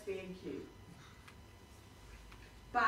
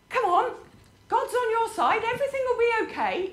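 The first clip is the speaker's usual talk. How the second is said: much higher and louder, hammed-up cheer; mock cheerful reassurance; 11 semitones higher, 9 dB louder, faster than usual. People cheering up someone struggling, mock cheerful. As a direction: overdo the bright pep talk. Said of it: "we have this habit of saying".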